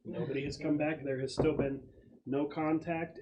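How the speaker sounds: normal talking voice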